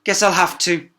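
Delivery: sulky princess yielding; snapped. A narrator's reading. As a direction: bite it out reluctantly. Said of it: "she snapped".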